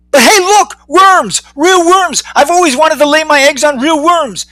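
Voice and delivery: very high, thrilled exclaiming; excited discovery. Acted. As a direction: gush over a dream come true. Leslie spots real worms.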